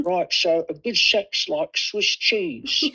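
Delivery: put-on old English gaffer in a Cockney accent. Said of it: "in his Cockney accent".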